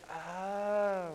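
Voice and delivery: soft dawning realization; sudden understanding; surprised oh. Acted. Bob suddenly getting God's explanation, soft surprised oh. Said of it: "he says".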